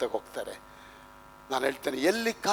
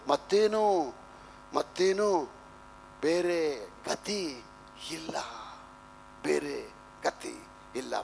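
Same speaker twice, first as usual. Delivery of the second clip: slow, soft, with long pauses; acted hopeless resignation; 7 dB quieter, slower than usual. Widow with her last bread and no other way, acted resignation. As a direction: let the words sink away, lightly acted despair. Voices widow with nothing left.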